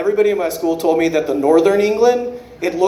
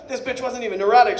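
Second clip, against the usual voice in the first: ordinary delivery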